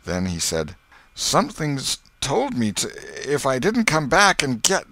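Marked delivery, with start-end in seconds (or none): 1.1-4.8 high, halting, broken-off confession; shuddering. A narrator's reading. Potter eased to the ground before the knife, shuddering and faltering. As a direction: falter in dread, voice raised in pitch. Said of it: "he shuddered".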